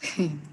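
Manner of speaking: brief, low amused chuckle; a single short chuckle; short laugh. Real, not acted. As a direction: let out a quick real laugh. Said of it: "why I'm laughing".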